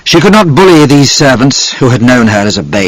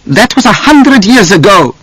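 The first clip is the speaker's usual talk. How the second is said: snapped; 11 semitones higher than usual. A narrator's reading. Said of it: "she snapped".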